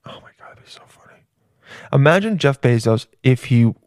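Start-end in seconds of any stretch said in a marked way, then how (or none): 0.1-1.2 barely audible whispered aside; hushed, private murmur. Real, not acted. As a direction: whisper it quickly to yourself.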